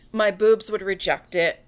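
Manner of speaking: usual speaking voice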